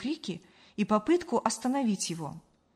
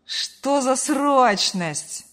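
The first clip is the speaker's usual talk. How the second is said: drawn-out, louder lazy answer; slow, higher, unhurried grumble; lazy reply; 4 semitones higher, 6 dB louder, much slower than usual. A narrator's reading. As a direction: call back slowly, can't be bothered. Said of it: "лениво откликнулся сын".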